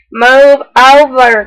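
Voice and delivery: loud, slow bossy growl. Acted. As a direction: order them to shove over. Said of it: "growled the bear".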